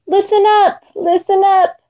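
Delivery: lightly voiced small, babyish whimper, pitched high; hurt Lion pleading like a little baby. A narrator's reading. Hurt Lion whimpering for help, babyish. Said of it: "In a little baby voice he whispered".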